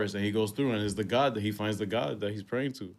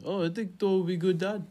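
light Korg impression, pitched up; 9 semitones higher than usual. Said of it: "Korg also mentioned".

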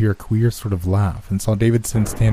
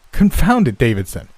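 high, loud burst of impatience; exasperated outburst; 5 semitones higher, 4 dB louder than usual. A narrator's reading. Friend answers startled Davidson, exasperated.

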